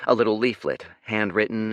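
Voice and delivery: usual speaking voice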